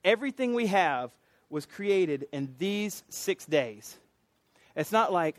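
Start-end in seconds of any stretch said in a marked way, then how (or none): none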